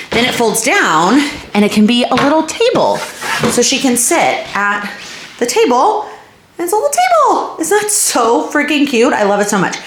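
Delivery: excited. Real, not acted. Gushing over toddler tower.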